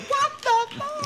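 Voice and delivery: very high, drawn-out shocked outburst; shocked supply teacher. Acted. Supply teacher finding a pupil gone, shocked high squeal. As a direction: cry it out high and slow, in mock shock. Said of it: "imagine the supply teacher coming".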